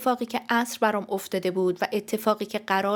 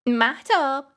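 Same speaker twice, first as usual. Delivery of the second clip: high, loud call of a name; urgent raised-voice summons; shouting; 6 semitones higher, 6 dB louder, slower than usual. Acted. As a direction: yell for someone behind a door.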